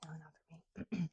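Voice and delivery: soft whispered verdict; whispering. Real, not acted. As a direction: whisper it slowly. Quiet verdict on the sample.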